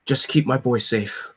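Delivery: normal talking voice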